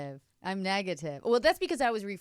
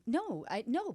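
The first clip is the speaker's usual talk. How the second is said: usual speaking voice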